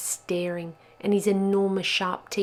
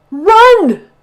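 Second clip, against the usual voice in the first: panicked shout; 22 dB louder than usual. Acted. Bubba fleeing the wolf.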